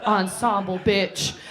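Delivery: ordinary talk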